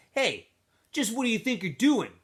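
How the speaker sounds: mild voiced threat to a bully; Squid's challenge. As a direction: confront the bully head-on. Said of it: "asked Squid".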